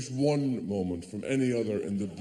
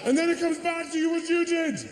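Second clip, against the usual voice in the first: sudden shout. Acted. Sudden realisation.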